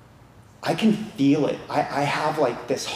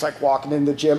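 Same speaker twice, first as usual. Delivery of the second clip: ordinary talk, nothing marked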